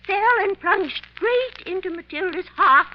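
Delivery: building to a horrified cry. Acted. Horrified realization of the murder.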